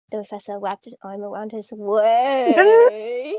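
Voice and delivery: slow, loud, breaking into laughter; laughing while reading. Real, not acted. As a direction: read through cracking laughter.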